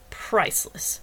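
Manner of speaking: unvoiced, scornful sneer. A narrator's reading. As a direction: sneer the word with contempt. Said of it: "Wagner sneered".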